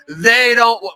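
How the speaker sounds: high-pitched imitated heckler's cry; hammed-up screamed protest, cut short; mock scream. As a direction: cry out like an indignant drunk heckler. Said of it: "she starts screaming".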